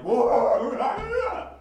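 very loud, high outraged dog howl; imitated frantic barking. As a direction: burst out like a dog losing its food. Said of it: "And Jerry's there like".

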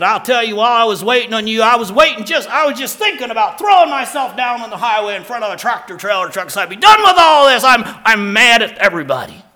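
loud, high, fast, furious rant; angry shouting man. Retold or imitated. Mick ranting at the pastor about being mad, loud angry shout. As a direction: rage like you're done with everything. Voicing angry Mick's quote.

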